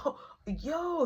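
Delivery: "Oh, yo" jumps up high in surprise; a caught-off-guard "Oh, yo"; startled greeting. Retold or imitated. Dude shocked at the door.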